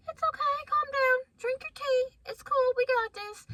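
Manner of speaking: high, soothing mock-instructor voice; soothing driving instructor. Imitating the first driver calming her after the ditch, high and soothing.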